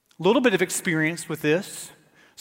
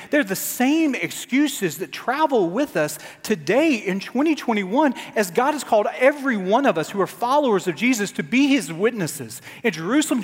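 ordinary talk

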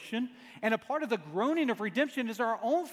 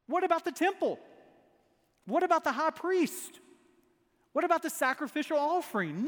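high-pitched, disbelieving "What about"; incredulous questions; 7 semitones higher than usual. Retold or imitated. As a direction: ask as if it cannot be true, pitched up.

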